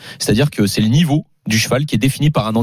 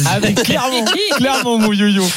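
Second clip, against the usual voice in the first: laughing; 8 semitones higher than usual. Real, not acted. Laughing at the teasing.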